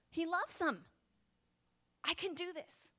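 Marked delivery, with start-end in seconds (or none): 2.0-2.9 softly muttered to herself, higher; quiet self-pep talk. Retold or imitated. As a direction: whisper courage to yourself. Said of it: "I say".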